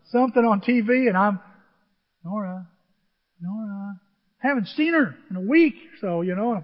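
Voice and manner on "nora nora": soft calling. Retold or imitated. Grandpa calling the distracted granddaughter.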